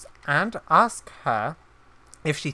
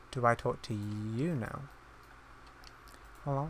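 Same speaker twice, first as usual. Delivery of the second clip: quieter repeat; 4 semitones lower, 12 dB quieter than usual. Real, not acted. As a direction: lower and softer, resigned repeat. Asking game character again.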